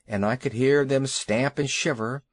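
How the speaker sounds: ordinary delivery, nothing marked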